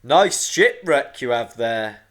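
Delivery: loud, slow thunderous rock creature; big stony monster voice. A narrator's reading. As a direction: boom it slowly like a talking island. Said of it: "a stony voice said".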